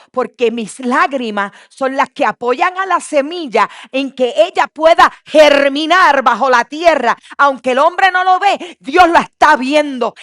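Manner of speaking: loud, rising sermon build; fervent preaching. Acted. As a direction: preach it with full fire. Preaching at full force.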